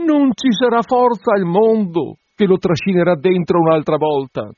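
put-on high, pleading young woman's voice; anxious bride. Bride coaxing the curate to wait, high and pleading.

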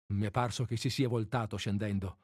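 usual speaking voice

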